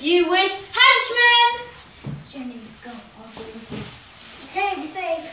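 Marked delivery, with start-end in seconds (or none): 0.0-1.7 slow, loud, high defiant shout; defiant taunt. Acted. Epic battle taunt. 2.3-3.7 quiet resolve. Acted. Self-sacrifice.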